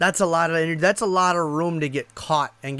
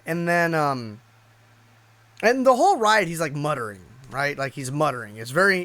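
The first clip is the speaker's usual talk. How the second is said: normal talking voice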